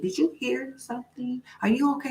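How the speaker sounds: lightly voiced scared girl; startled. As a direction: ask your sister, spooked and hushed. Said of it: "I was looking and I said".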